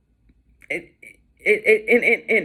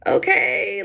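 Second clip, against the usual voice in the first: sarcastic okay; 12 semitones higher, 3 dB louder than usual. Retold or imitated. Resigned reply to people.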